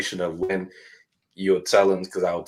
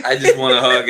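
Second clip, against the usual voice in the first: mock wife's plea; 5 semitones higher, 9 dB louder than usual. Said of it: "she's like".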